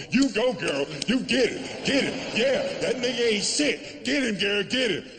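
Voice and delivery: put-on high-pitched cheering girlfriends; imitated women goading a friend; women egging her on. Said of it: "And the women around her going".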